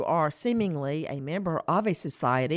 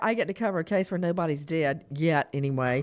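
ordinary talk, nothing marked

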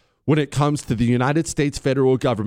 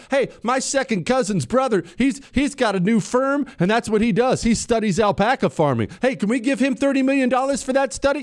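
higher-pitched, eager mock pitch; mock crony making his pitch in a raised voice; scheming crony; 8 semitones higher than usual. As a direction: put on an eager insider angling for cash.

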